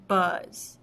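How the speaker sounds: "Buzz" said once, deliberately, to feel the jaw drop; one clear beat for the chin test; syllable-counting read. Shown as a demonstration. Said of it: "put our hands under our chin and say the word".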